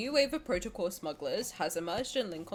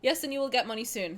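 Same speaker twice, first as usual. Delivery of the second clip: ordinary talk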